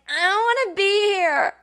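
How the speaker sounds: high, whimpering crybaby wail; whiny baby cry. Retold or imitated. Waking from surgery, whining to the nurse like a crying baby. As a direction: whine it like a drugged baby. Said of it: "whining like a baby".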